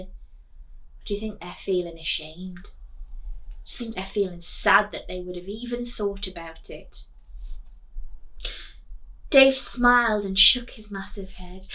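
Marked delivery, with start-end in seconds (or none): none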